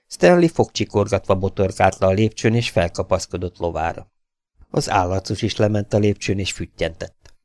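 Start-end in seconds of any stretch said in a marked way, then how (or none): none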